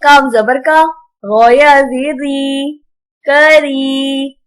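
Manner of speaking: slow, measured recitation with long-held vowels; Quran spelling drill. Shown as a demonstration. Teacher spells out Arabic words for pupils, drawn-out recitation. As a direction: chant each syllable out for pupils.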